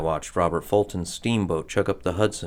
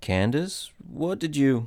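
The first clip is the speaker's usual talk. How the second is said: higher, anxious questioning; dawning dread, pitch climbing; alarmed question; 7 semitones higher, slower than usual. Acted. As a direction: ask in rising alarm. Husband alarmed at what witch did.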